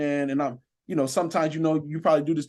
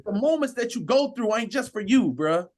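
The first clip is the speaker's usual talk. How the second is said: preaching; 7 semitones higher than usual. Acted. Officer preaching to listeners about speaking life, fervent.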